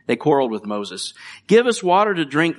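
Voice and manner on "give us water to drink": higher, louder mock demand. Preacher voicing the thirsty crowd's demand, loud and high. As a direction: bark the demand like a grumbling crowd. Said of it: "they demanded".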